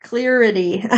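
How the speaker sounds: "Clarity" said as "cleer-ity"; read as spelled. Real, not acted. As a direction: say "Clarity" with "clear" as its first syllable. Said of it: "why is it spelled like that".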